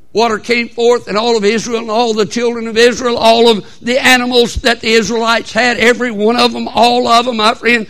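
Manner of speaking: high, loud pulpit shout; full-force preaching. Acted. Preacher urging church about trusting Christ, full-force preaching.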